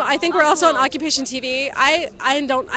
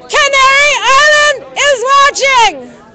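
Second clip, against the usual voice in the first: shouting; 12 semitones higher, 10 dB louder, slower than usual. Real, not acted. Calling out to distant crowd.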